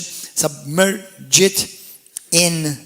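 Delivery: "submerge it" drawn out very slowly, syllable by syllable; sounded out slowly. Real, not acted. Preacher sounding out submerge it for the congregation, slow and syllable by syllable. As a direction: break "submerge it" into slow, separate pieces.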